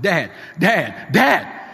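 high, loud panicked cries for Dad; frantic. Retold or imitated. Son phoning his father after a terrible accident, frantic. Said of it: "Stephen called me frantic".

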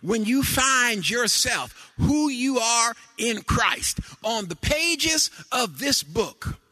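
high, loud whooping sermon cadence; preaching. Acted. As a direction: build the congregation up with each line. Preaching at full force with organ.

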